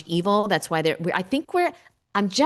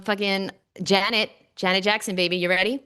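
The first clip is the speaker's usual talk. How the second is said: ordinary talk, nothing marked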